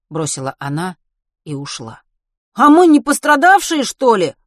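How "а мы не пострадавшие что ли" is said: high, loud protest; yelled indignant challenge; indignant shout. A narrator's reading. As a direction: shout it after someone leaving. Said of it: "крикнул ей вслед Матвей".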